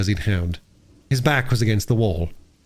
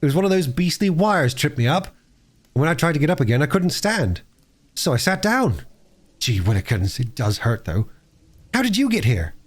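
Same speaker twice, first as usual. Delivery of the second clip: lightly voiced boy, mostly higher-pitched; injured boy, indignant; 6 semitones higher than usual. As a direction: explain the fall, then wince a little.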